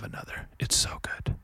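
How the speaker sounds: hushed reverent whisper. Real, not acted. Savoring scotch to listeners in a whisper. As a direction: breathe it like a secret.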